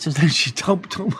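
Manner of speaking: normal talking voice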